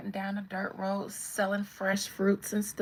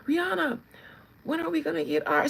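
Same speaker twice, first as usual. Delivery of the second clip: high, louder mock fan voice; pestering fans; 8 semitones higher, 5 dB louder, faster than usual. Fans pressing the singer for the album, high insistent voice. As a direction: imitate insistent fans, pitched up and quick. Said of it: "Every time y'all be like".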